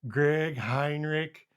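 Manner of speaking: slow, drawn-out Lou Holtz call of the name. Retold or imitated. Coach calling his kicker from the sideline, drawn out. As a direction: do your best Lou Holtz calling a player. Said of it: "best Lou Holtz voice".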